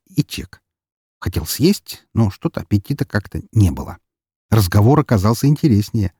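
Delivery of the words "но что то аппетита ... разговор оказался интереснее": ordinary delivery, nothing marked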